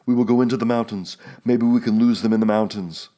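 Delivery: decide and drive them off now; fierce resolve. A narrator's reading. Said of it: "his eyes were fierce again".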